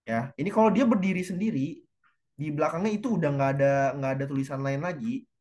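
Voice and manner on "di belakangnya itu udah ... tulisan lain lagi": usual speaking voice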